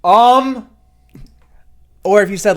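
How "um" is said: smug, drawn-out mocking um; mock the student with a smug um; smug teacher. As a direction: nonchalant teacher imitating a filler. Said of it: "so nonchalantly and so smug".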